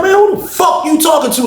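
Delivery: laughing outraged shout. Retold or imitated. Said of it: "I'm looking at this dude like".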